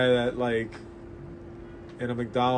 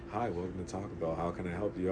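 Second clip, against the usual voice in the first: low, soft late-night radio host; smooth jazz host; 8 semitones lower, 13 dB quieter than usual. Retold or imitated. Drive-thru worker greets a customer like a smooth jazz host. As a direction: imitate a mellow jazz DJ, hushed and low. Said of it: "sound like a smooth jazz host".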